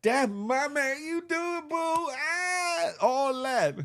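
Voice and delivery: put-on high, slow gushing girlfriend voice; proud girlfriend. Girlfriend cheering her man on, high and gushing. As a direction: gush over your man in a high voice. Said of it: "She was looking back at the camera like".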